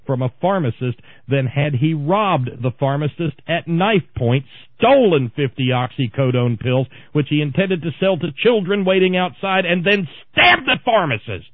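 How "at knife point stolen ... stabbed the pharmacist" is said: rising from firm to loud high shouting; building to a scream. Real, not acted. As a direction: climb into a full outraged yell. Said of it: "you're screaming again".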